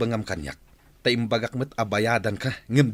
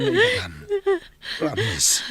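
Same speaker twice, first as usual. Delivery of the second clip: desperate promise; slower than usual. Acted. Husband begging forgiveness.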